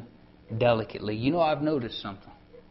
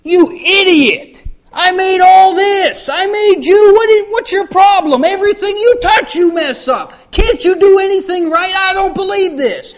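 screaming in a very high, very loud fury; furious screaming God; 14 dB louder than usual. Pretend. The raging God scolding Adam, furious shout. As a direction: rage at Adam like a father losing it. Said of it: "He didn't say".

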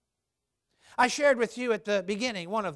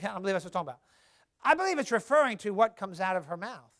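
usual speaking voice

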